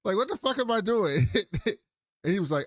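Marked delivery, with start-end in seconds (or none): 0.0-1.3 high, exasperated mock panic; panicked outburst. Teammate recalling a confused moment, high mock panic. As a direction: retell his freak-out in a high voice. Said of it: "He's like".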